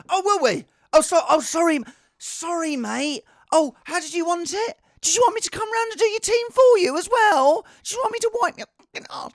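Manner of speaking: high-pitched mock-indignant rant; sarcastic retort. Streamer answering demanding viewers, sarcastic outrage.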